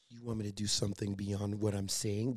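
low, soft come-on; the grandma's line turned soft and seductive; sultry flirty whisper. Retold or imitated. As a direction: purr it as a seductive offer. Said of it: "if she said it like".

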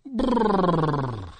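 long trilled brrr sliding down from high. Real, not acted. Radio host between songs, drawn-out falling trill.

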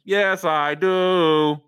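a slow, loud sing-song cadence; slow Rodman cadence. Retold or imitated. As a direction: drag each word out in a heavy cadence.

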